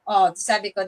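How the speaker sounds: ordinary delivery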